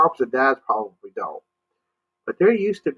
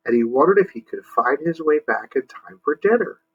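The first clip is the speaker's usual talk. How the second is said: ordinary delivery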